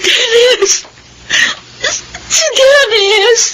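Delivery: high tearful cry, slow on the second. Acted. Widow waking from dream, crying out for husband. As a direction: wail his name as you wake. Julie wakes crying for Dennis.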